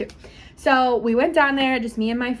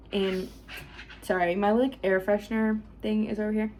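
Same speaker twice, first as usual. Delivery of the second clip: ordinary delivery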